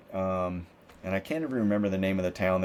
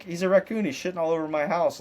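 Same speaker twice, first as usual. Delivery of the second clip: higher, exasperated mock voice of the friend; imitating a fed-up friend; 8 semitones higher than usual. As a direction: do the friend who's had enough of the raccoon.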